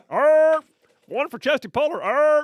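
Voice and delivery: high, loud battle yell; gung-ho roar. Retold or imitated. Marine roaring with joy as he runs off to the gig pit. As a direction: roar like you're charging into it.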